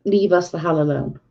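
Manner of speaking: normal talking voice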